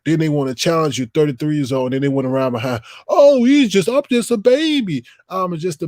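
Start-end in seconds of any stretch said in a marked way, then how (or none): 3.1-5.9 high, whiny mocking baby voice; squeaky high sarcastic pleading. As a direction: mimic a kid playing the helpless baby. Mocking kids who plead they're young.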